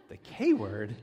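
the K word lifted into a puzzled rising question; dad startled by his son's answer, rising; surprised echo. Retold or imitated. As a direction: repeat it back in disbelief. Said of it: "dad's reply".

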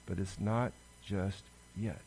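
slow, soft and drawn out, leaning on not; hushed and slow. Acted. To the congregation on the waiting of Advent, hushed and slow. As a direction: let it sink in quietly, holding back. Preacher stresses that Advent waits.

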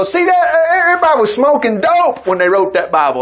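high, sneering sceptic's jibe; mocking scoffer. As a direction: ham up the scoffer mocking the Bible. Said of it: "some people, they go".

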